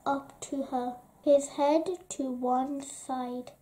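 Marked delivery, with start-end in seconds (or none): none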